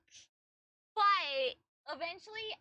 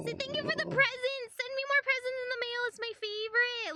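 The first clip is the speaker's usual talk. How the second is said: excited pet talk; 5 semitones higher, 5 dB louder than usual. Real, not acted. Gushing at the dog on camera.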